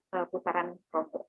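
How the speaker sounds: ordinary delivery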